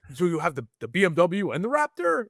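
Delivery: high retold disbelief; imitating dad. Dad voiced in retold talk.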